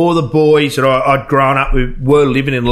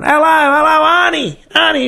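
high, put-on caller voice; prank call voice; 14 semitones higher than usual. Prank caller greeting Auntie Joyce, high put-on voice. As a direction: fake a relative on the phone.

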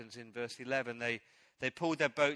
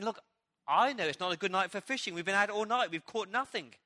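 exasperated tired fisherman, a bit raised and louder; fed up after a long empty night; frustrated; 5 semitones higher, 5 dB louder than usual. Retold or imitated. Simon tells Jesus the night was fruitless, frustrated. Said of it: "Simon's kind of frustrated".

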